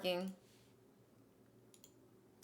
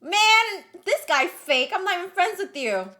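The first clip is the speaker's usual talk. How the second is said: loud mock outrage; 9 semitones higher, 9 dB louder than usual. Real, not acted. Mock outrage at fake guy.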